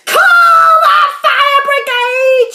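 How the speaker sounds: high, drawn-out, full-force shout; sudden shout. A narrator's reading. Grandma burning inside from the medicine, sudden shout. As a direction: shout for help at the top of your voice. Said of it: "she shouted suddenly".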